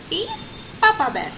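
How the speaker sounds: usual speaking voice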